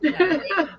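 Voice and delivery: high, helpless burst of laughter; real high giggle; dying laughing. Real, not acted. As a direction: crack up in a high-pitched laugh. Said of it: "I'm dead".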